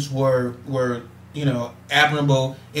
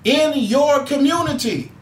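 higher, driving preacher's cadence building up; impassioned call to action; preaching; 7 semitones higher than usual. Acted. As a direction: fire up the audience like a Sunday sermon. Rises into preaching to listeners.

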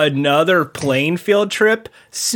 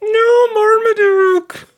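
mock wailing. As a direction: cry out in mock grief. Wailing over the dog.

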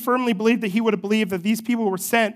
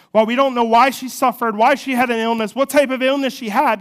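louder, higher preaching climax; 4 semitones higher, 6 dB louder than usual. Acted. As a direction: build it to a passionate peak.

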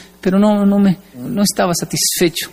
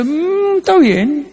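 high, offhand sniff at someone else's skill; mock smug dismissal, pitched up; dismissive; 10 semitones higher, much slower than usual. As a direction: brush it off like a proud know-it-all. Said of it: "ve a alguien ... y dice".

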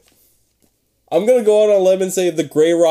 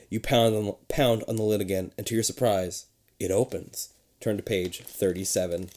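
much softer, low suspense; hushed suspense; 8 semitones lower, 8 dB quieter than usual. A narrator's reading. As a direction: let it drop to a hush. Suspense fades into hush.

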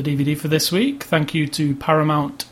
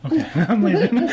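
laughing; 9 semitones higher than usual. Pretend. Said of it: "and then they'll go".

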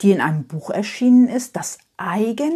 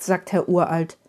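plain reading voice

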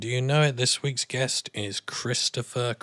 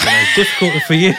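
laughing tease; 11 dB louder, much faster than usual. Real, not acted. Teasing guest, laughing.

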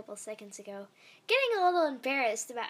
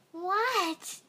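put-on whiny kid-sister voice, a bit higher; annoying voice; 7 semitones higher, 3 dB louder than usual. A narrator's reading. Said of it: "most annoying voice as she could muster".

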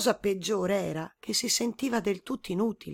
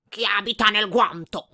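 louder, gruffer boar voice; wild boar asking; 6 dB louder than usual. A narrator's reading. Boar asking who lives in the glove, loud. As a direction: demand it like a big boar.

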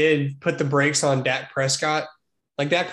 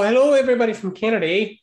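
put-on Canadian accent; 7 semitones higher, 4 dB louder, faster than usual. Jokey Canadian greeting.

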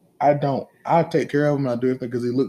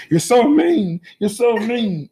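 high, loud mock outburst; escalating complaint; 10 semitones higher, 3 dB louder, slower than usual. Kristen snaps at dad after being sent away, mock upset. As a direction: imitate an upset kid hurling accusations. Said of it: "It just escalates".

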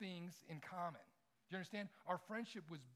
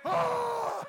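much higher, much louder sudden cry; startled yell of pain; 20 dB louder than usual. Retold or imitated. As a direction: yelp like a kid just cut by a knife. Friend's hand gets cut.

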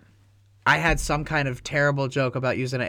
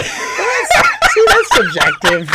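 high laughing squeal; 11 dB louder, much slower than usual. Real, not acted. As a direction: laugh it out while joking. Laughing at question.

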